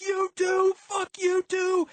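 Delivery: very high, loud screech. A narrator's reading. Violet turns on the narrator trying to calm her, screeching. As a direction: screech it and drag out the words. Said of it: "but Violet screeched".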